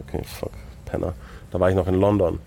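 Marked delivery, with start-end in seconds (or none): 0.1-1.1 hushed, unvoiced-sounding curse under the breath; resignierter Fluch. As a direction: swear softly to yourself, giving up on him. Retold giving up after no reply. (leise in sich hinein geflucht)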